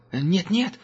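high, hurried scared denial; the angel lies to Gerda, high and frightened; panicky denial. A narrator's reading. Captive denies in panic.

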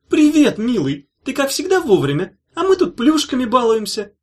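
high sweet loud coo. A narrator's reading. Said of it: "проворковала она".

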